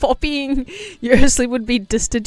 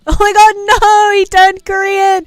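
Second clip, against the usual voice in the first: loud, high hammed-up alarm; mock panic; 9 semitones higher, 7 dB louder than usual. Streamer fakes horror at a game character, loud and high. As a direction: shriek in fake horror.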